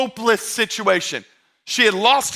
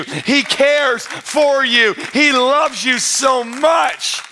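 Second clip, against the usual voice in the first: preaching at full force; 4 semitones higher than usual. Acted. Pastor assuring the abandoned listener that Jesus stays, full preaching. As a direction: drive the comfort home from the pulpit.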